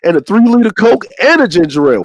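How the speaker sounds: usual speaking voice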